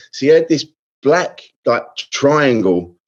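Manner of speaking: ordinary delivery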